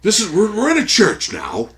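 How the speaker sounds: loud, high-pitched call to a congregation; hammed-up call across a church; mock preacher shout. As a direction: project it like a church performer. Acting out the church scene.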